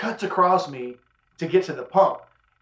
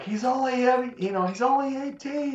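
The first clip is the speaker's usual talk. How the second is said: put-on much higher, slow girlfriend voice; pleading girlfriend; 10 semitones higher than usual. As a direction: plead for him, pitched way up.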